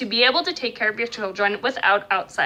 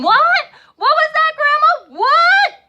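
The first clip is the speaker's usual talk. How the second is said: mock shout, much higher and louder, drawn out; yelling into the phone; 5 dB louder, slower than usual. Parent shouting greetings to Grandma over a bad line, mock yell. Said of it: "yelling and shouting".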